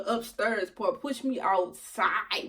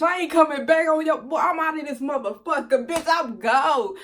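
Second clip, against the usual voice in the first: loud, higher, defiant outburst; emphatic getaway boast; 5 semitones higher, 7 dB louder than usual. Retold or imitated. Clubgoer escaping the man she lied to, loud defiance. Confessing her lie about returning.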